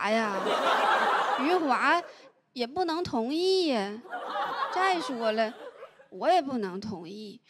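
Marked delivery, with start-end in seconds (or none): none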